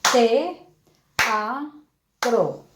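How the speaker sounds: "Te, a, tro" spoken as three even clapped beats; one clap on each syllable of "Te, a, tro". Shown as a demonstration. Teacher clapping out syllables with students, one per beat. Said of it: "Pronunciémoslo con nuestras palmaditas".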